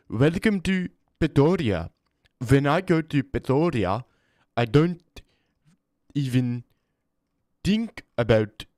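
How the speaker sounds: attempted South African accent, slow and drawn; mock South African accent greeting. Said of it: "trying to do the South Africa accent".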